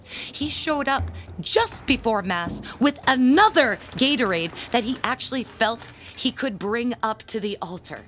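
normal talking voice

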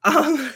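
a high, nervous laugh through tears; a short bright laugh, close to crying; teary laugh. Real, not acted. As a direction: laugh off the emotion. Laughs at own tearing up.

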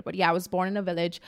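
usual speaking voice

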